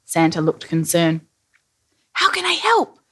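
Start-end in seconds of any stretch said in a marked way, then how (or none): 2.1-3.0 very high, eager little character; Billy, eager to help. A narrator's reading. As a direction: pipe up at once, high-pitched. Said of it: "asked Billy immediately".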